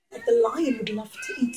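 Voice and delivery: ordinary delivery, nothing marked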